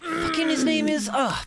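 high, strained groping for a name; tip-of-tongue groping. Acted. Game master acts Ragna nearly recalling a name, groping. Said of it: "is it on the tip of your tongue".

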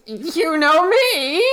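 high, slow, wavering question; hammed-up timid bewilderment; bewildered captive. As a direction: answer in a slow, high, confused voice. Intimidated man plays along, confused.

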